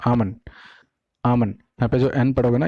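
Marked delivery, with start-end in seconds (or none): 0.1-1.5 आमन as AA-mun, barely any D; silent L. Shown as a demonstration. Teacher repeating for learners, AA-mun. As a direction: say आमन with long AA and no L. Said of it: "एल को पूरा silent कर दो".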